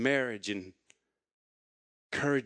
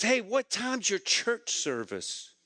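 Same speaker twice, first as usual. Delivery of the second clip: higher, slow, lightly voiced friend; easy curious question; friend's question; 6 semitones higher, slower than usual. Friend in the shower asking about church times, lightly voiced. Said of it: "he goes".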